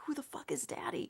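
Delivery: stunned whisper. Retold or imitated. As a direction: whisper it in disbelief. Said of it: "I was like".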